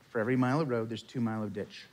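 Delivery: solemn. Real, not acted. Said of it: "there is some truth to the old Irish proverb".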